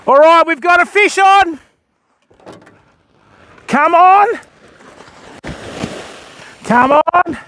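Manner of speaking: pumped up, willing the fish in; excited. Real, not acted. Angler feels a bite and urges the fish on, excited.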